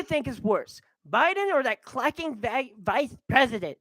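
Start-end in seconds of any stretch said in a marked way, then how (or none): none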